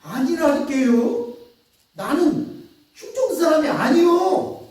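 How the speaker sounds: loud, high, swearing it; desperate oath. Acted. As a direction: swear on your life in a panic. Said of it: "그러자 뭐라고 얘기합니까?".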